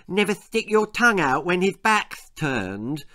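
put-on high-pitched lisping child; lisping little sister. Little sister suggesting to the boys, lisped. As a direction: pipe up as a small girl. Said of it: "suggested Lizzie".

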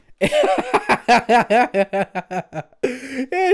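high, loud burst of laughter. Real, not acted. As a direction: laugh at yourself. Laughs at own mistake.

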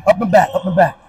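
usual speaking voice

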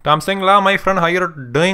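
put-on friendly Indian accent, retroflex t and d. Prank-calling the drive-thru worker in a mock Indian accent.

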